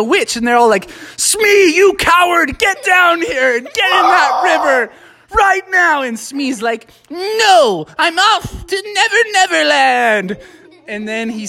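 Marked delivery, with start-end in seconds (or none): 1.2-6.0 high-pitched scolding shout; indignant yell. Acted. Animals ordering cowardly Smee down off his mop, indignant yell. 7.1-10.4 high, theatrical refusal; defiant getaway cry. Acted. Smee fleeing on his magic mop, defiant high cry.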